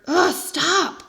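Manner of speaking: ordinary delivery, nothing marked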